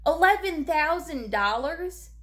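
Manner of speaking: rising disbelief at the price; incredulous. Real, not acted. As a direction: repeat it slow and shocked. Outraged repeat of price.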